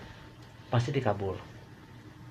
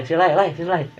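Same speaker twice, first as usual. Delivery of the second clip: higher, louder repeated call; 6 semitones higher, 6 dB louder than usual. Acted. Tire repairman calling his friend, higher louder call. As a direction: hail someone across the yard.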